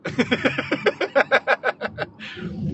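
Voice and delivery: long staccato laugh; drawn-out self-mocking cackle; laughing at oneself. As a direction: laugh helplessly at your own foolishness. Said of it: "I be sitting back laughing".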